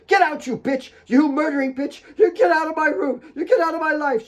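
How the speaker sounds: very high, loud screaming rage; furious scream. Acted. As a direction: scream her out of the room. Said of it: "hurled it at Claire".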